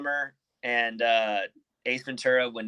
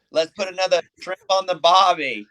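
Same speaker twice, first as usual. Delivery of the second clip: put-on higher, louder movie-quote Aussie accent; mock Aussie accent; 7 semitones higher, 6 dB louder than usual. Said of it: "like on, uh, Dumb and Dumber".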